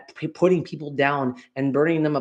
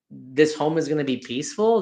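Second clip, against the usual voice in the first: usual speaking voice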